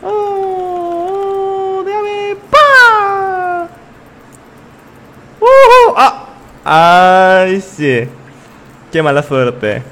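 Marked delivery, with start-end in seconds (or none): 0.0-3.7 much higher, squeaky excited voice; excited squeal. Real, not acted. Streamer reacting mid-fight, high excited squeal. 5.4-7.6 loud, much higher triumphant whoop. Real, not acted. Streamer celebrating a kill, loud high whoop. Cheering a play.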